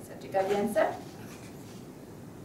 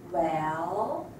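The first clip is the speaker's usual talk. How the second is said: "well" scooped low and back up; falling-rising tone; 5 semitones lower than usual. Shown as a demonstration. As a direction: dip "well" before letting it climb. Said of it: "a falling and rising tone".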